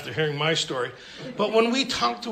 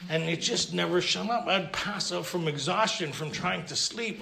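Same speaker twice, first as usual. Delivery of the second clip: ordinary delivery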